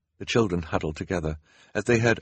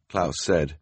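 usual speaking voice

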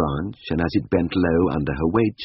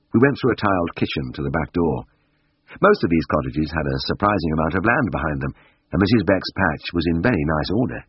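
ordinary delivery

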